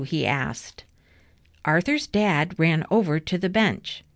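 plain reading voice

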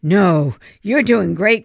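plain reading voice

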